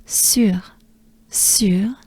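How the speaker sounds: Sur with rounded U and breathed r; French sur. Shown as a demonstration. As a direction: separate Sur, r melting into breath. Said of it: "is a French breath".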